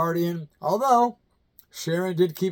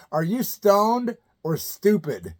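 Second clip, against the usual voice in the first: reading the card; 4 semitones higher, slower than usual. Shown as a demonstration. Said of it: "I had a card that said".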